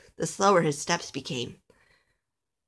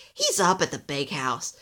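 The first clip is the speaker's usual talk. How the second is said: lightly voiced grumpy elf, a touch low; 3 semitones lower than usual. A narrator's reading. Busy elf answers little Alistair, grumpy. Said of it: "said a grumpy elf".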